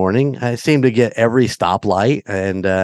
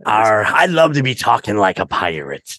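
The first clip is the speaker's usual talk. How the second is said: gruff playful pirate growl. Pretend. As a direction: ham up a pirate. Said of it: "That's actually a pretty decent pirate voice".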